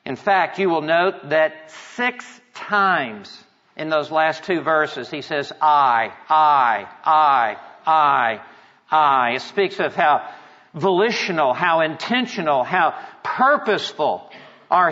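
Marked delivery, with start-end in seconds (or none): 5.6-9.3 each "I" punched louder on a low pitch; low, forceful "I", set apart by short pauses; emphatic repetition. Retold or imitated. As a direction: hammer out every "I" as its own separate, weighty beat. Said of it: "it is so emphatic".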